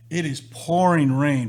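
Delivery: "It is pouring rain" said slowly, each word leaned on; slow emphasis. Retold or imitated. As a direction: drag out "pouring" to stress the downpour. Repeats it for emphasis.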